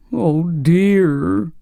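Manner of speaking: a mild, drawn-out moan of woe; Jack's mild moan over his fate. A narrator's reading. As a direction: sigh it out like a sorry pumpkin. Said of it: "moaned Jack".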